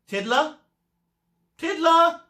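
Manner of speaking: high, loud call of a name. Acted. Miss Skate calls the register, a loud high call. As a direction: call across the classroom for him. Teacher calling absent pupil again.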